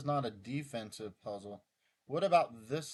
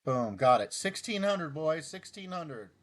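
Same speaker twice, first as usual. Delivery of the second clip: triumphant cheer; 3 semitones higher, 9 dB louder than usual. Real, not acted. Streamer cheering his new rating to the boys, loud triumphant. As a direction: crow about the win. Celebrating a solved puzzle rating.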